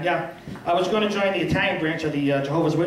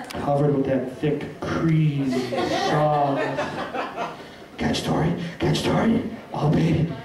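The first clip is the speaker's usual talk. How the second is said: low, slow, sultry food talk, much lower; phone sex voice; 6 semitones lower, slower than usual. Retold or imitated. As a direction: purr the menu like a lover on the phone. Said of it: "like watching somebody have phone sex".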